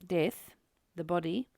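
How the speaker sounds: ordinary delivery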